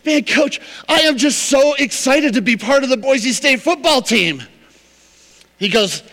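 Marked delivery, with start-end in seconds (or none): 0.1-0.6 high, eager gushing; excited kid. Retold or imitated. Rookie tells coach he's thrilled, high and eager. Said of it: "And he's like". 0.9-4.4 high, eager gushing; eager rookie. Retold or imitated. Rookie first tells coach he's excited, high gushing. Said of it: "And he's like".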